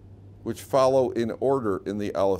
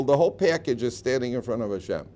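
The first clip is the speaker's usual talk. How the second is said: ordinary talk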